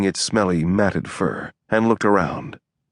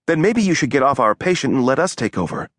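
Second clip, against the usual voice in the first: higher-pitched cutting dismissal; 6 semitones higher than usual. A narrator's reading. Allie ordering Dex off the patient, cutting. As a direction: cut them down, voice raised in pitch. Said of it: "hadn't meant to sound so cutting".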